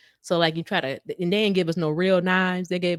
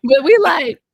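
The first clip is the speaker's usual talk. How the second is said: loud, high amused protest; animated rebuttal; 9 semitones higher, 10 dB louder than usual. Real, not acted. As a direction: fire back loudly over the laughter. Excited reply to the interjection.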